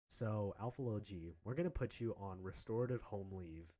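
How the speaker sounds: lightly voiced dean, low and soft; a hint of a grave administrator; solemn official. Voices official announcing suspension.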